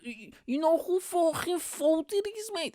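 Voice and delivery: very high, strained drunk mimicry; drunk guy impression. Stranger answering what he is talking about, high drunk voice. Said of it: "he was telling me".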